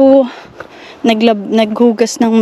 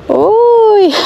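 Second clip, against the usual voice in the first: high surprised laugh; startled laugh; 12 semitones higher than usual. Real, not acted. Mother seeing her child slide, high startled laugh.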